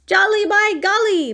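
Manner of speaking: high, loud storybook bear outburst; hearty exclamation. A narrator's reading. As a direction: burst out with jolly vigor. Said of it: "Barnaby said with a kick".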